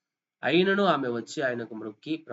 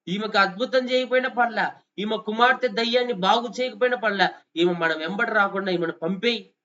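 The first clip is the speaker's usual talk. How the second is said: impatient disciples; 6 semitones higher, 5 dB louder than usual. Acted. Disciples urging Jesus to send her.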